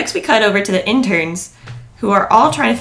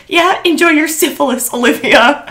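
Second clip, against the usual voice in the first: yelling; 6 semitones higher, 4 dB louder than usual. Retold or imitated. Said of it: "She screamed that".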